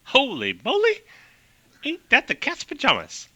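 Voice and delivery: high, delighted 1930s exclamation; old-timey amazement. Acted. Amelia gasping at hearing the year, high and amazed. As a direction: gush like a flapper pilot. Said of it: "really fast clipped talk like Katharine Hepburn".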